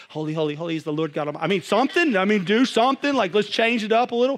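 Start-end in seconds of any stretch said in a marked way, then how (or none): 0.1-1.4 rattled-off quick refrain; fast, tossed-off chant; mock remix. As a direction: race through the words at double speed. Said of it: "remix it just like".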